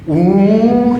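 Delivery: loud, high push grunt; sharp exertion grunt; effort grunt. Shown as a demonstration. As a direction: grunt as you throw the arms.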